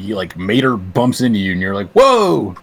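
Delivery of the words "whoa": high, loud startled whoa; startled cry. Acted. Bit-part actor bumped by Mater, startled. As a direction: yelp it as if bumped into. Acts one startled movie line.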